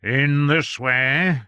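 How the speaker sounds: very slow, loud pronouncement; deliberate. Acted. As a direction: draw it out pompously. Doctor Robert lecturing.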